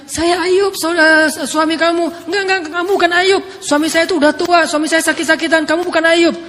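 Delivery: loud, high insisting back and forth; insistent exclamations. Retold or imitated. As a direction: protest the stranger isn't her husband. Ayub and wife argue identity. (istri menyangkal dengan suara tinggi dan keras)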